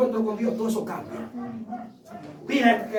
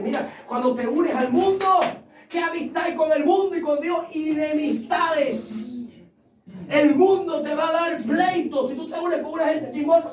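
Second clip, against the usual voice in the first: preaching; 5 semitones higher than usual. Acted. Preacher's emphatic delivery.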